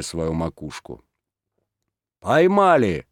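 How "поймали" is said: loud, higher triumphant call; loud excited cry of the constables; shouted cry. Acted. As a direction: burst in shouting the news. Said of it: "вскрикнули вошедшие".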